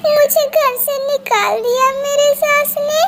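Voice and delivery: put-on young crow crying after her mother-in-law threw her out; crying bride. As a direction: sob it out.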